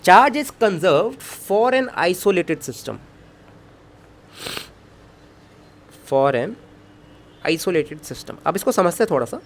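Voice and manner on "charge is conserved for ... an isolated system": slow dictation with stress on "isolated system"; teacher dictating the correct rule; correct statement dictated. Shown as a demonstration. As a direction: spell out the right rule slowly. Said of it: "जो कि गलत है".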